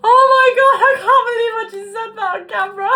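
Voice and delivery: shrieking with laughter, very high and loud; helpless laughter at her own slip; laughing hard. Real, not acted. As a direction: laugh through the words, mortified.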